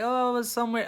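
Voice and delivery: high, breezy mom voice; mom's casual excuse. Retold or imitated. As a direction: toss it off as his mom.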